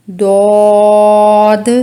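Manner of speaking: दौद held long and loud; long daad. Shown as a demonstration. Teacher models a long, loud दौद. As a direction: stretch दौद out fully. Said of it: "इसमें भी तीन हरूफ हैं".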